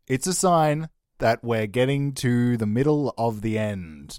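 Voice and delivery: ordinary talk, nothing marked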